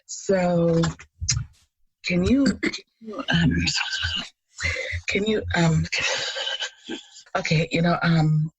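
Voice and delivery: halting, long pauses between false starts; hemming and hawing. Retold or imitated. Daughter nervously asking dad for money, hemming and hawing. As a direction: stall and stumble over an awkward request. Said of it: "I was hemming and hawing".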